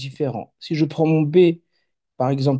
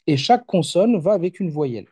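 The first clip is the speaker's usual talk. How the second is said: ordinary talk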